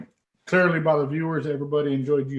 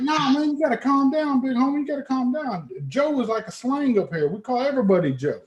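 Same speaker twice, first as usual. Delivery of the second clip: much higher, soothing mock voice of the local; imitating Chicago guy; 9 semitones higher than usual. Chicago stranger telling him to calm down, mock soothing. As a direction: play the guy calming him down.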